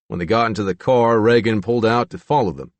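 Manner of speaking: ordinary delivery, nothing marked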